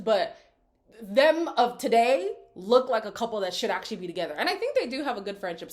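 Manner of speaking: ordinary talk, nothing marked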